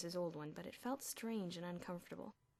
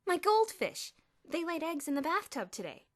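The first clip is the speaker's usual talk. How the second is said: put-on nerdy boy voice; 10 semitones higher, 12 dB louder than usual. A narrator's reading. Panicked Elmer explains fish.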